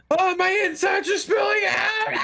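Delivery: high crying shout; wounded soldier gasping. Pretend. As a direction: scream in mock agony. Voicing dying human.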